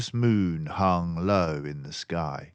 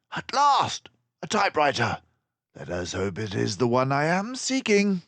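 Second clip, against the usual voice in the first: high, loud, excited outburst; triumphant discovery; cried out; 9 semitones higher, 5 dB louder than usual. A narrator's reading. Basil finding the typewriter, crying out. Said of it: "Basil cried".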